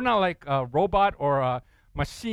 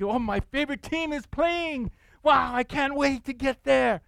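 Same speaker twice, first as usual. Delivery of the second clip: much higher, giddy fan voice; hammed-up fan, much higher; mock sports fan; 9 semitones higher than usual. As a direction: gush about the big game.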